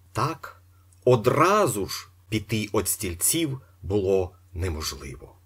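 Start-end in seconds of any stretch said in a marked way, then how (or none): none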